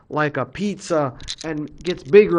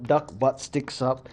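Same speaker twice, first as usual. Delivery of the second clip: ordinary delivery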